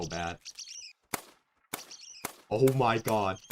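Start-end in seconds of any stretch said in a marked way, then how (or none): 2.5-3.4 loud surprised exclamation. Real, not acted. Reacting to missed shot.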